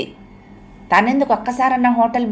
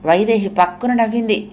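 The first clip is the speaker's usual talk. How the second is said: plain reading voice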